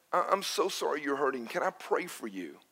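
soft, hushed, tender offer to pray; tender comfort. Retold or imitated. Pastor softly comforts a weeping girl at the pump, tender and hushed. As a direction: comfort her gently, almost under your breath.

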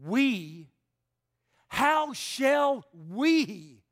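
"we" loud and stretched out, with a swooping fall; emphatic WE. Shown as a demonstration. Preacher showing the Greek emphasis, loud stress on "we". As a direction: hit "we" hard and draw it out. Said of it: "an emphatic word here".

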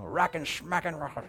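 lightly mimicked cartoon grumbling; soft, garbled mock cussing; Yosemite Sam. Preacher mimicking bleeped swearing, cartoon grumble. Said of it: "Yosemite Sam".

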